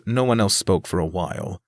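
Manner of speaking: usual speaking voice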